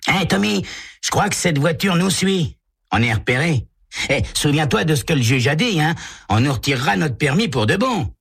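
put-on high boyish voice, a touch uneasy; teen passenger, mildly worried; nervous teen. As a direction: warn your buddy they're being followed. Walt worries they're followed.

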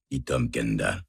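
ordinary delivery, nothing marked

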